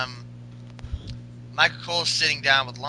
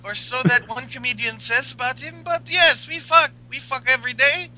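put-on higher, louder Russian-accented woman; Russian accent Lana; 8 semitones higher, 6 dB louder than usual. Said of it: "Lana's like".

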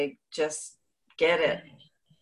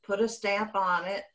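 ordinary talk

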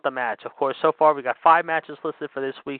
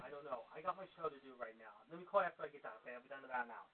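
far from the mic, much softer; off-mic phone call; 24 dB quieter, faster than usual. Real, not acted. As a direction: wrap up the call away from the mic. Host ends phone call off mic.

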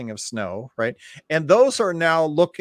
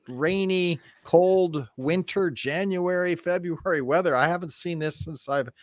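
ordinary delivery

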